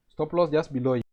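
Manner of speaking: normal talking voice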